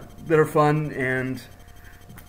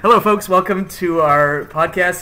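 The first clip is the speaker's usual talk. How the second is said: podcast host intro; 4 semitones higher, 7 dB louder, slower than usual. Acted.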